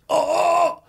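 high, loud startled oh; surprised fan. Retold or imitated. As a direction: yelp of sudden recognition. Fan spots him in Coles.

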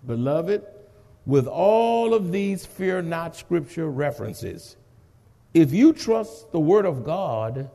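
ordinary talk

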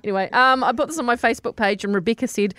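ordinary talk, nothing marked

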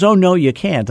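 high-pitched imitated protest. Client tells agent he can't come up, imitated protest. Client objecting to his visit.

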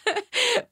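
real giggle, high and loud; bubbling up into a real laugh; high burst of laughter. Real, not acted. Laughs at her own bit.

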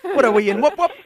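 high, loud whoop whoop; triumphant hammed-up Aussie cry; excited. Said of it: "I'm already a local".

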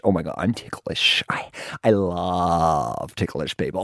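breathless excited whisper. Retold or imitated. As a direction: hush it like a secret thrill. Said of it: "she's like".

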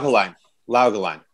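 put-on German reading of "Laugeline"; mock German pronunciation. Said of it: "The, the German pronunciation".

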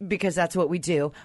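ordinary delivery

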